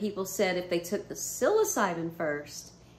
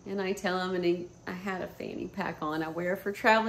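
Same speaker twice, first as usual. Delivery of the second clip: ordinary delivery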